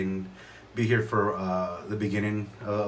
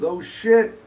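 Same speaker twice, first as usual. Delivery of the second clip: higher, louder startled cry; mock shock; 13 semitones higher, 8 dB louder than usual. Reading his daughter's card aloud, a loud mock startled yelp. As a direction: blurt it out like a startled kid. Card's pop-up line read aloud.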